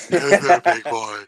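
slow mimicry of a mock-female character; imitated female voice. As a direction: lightly copy the co-host's character, drawn out. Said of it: "That's my female voice".

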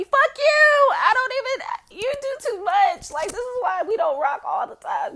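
loud, high-pitched rant; playful outraged yelling; indignant outburst. Acted. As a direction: tell him off in a high squeal. Indignant tirade at his suggestion.

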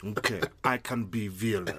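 light Russian accent, a cartoon villain. Co-host plays the podcast villain in a soft Russian accent. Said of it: "that was a really great Russian accent".

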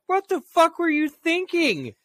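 high, incredulous yell at the wife; retold angry yell. Husband yelling at his wife over the dog. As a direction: throw it at her high, disbelieving.

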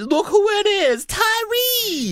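high, excited call-out; excited greeting. Acted. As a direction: hail a buddy with delight. Greeting arriving friend excitedly.

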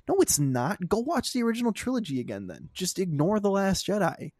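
high, loud, fast retort; angry retort. Real, not acted. Answering mocked fans, a high loud angry retort. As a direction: snap back at them.

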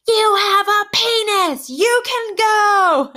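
high, loud, drawn-out imitated child; kid's shout. One of my kids telling classmate to go, shouting. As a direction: holler it slowly like an exasperated kid.

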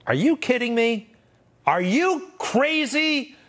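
high, drawn-out disbelief; incredulous outrage. Real, not acted. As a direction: stretch it out high in indignant astonishment. Outraged at troops turning their backs.

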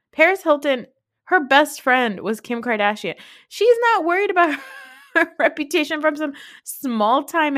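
high, loud disbelieving rant; incredulous rant. Real, not acted. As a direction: build up the disbelief. Ranting sarcastically about Paris Hilton.

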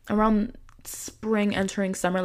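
usual speaking voice

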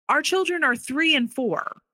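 high-pitched incredulous protest; retold disbelief. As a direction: voice lifts high in retold shock. Retold reaction to divorce threat.

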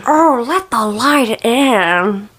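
very drawn-out put-on voice; singer impression. Shown as a demonstration. Showing a friend an impression, slow put-on voice. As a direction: stretch every word out slowly in character. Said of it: "my impressions are good".

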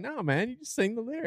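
soft, high, casual brush-off. Retold or imitated. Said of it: "But [name] was like".

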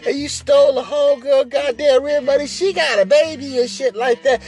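angry woman on the phone. Retold or imitated. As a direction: pitch it up and scold. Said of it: "Phone going off".

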